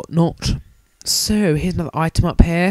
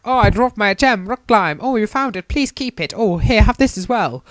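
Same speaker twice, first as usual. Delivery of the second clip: NPC voice; 7 semitones higher than usual. Retold or imitated. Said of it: "He's like".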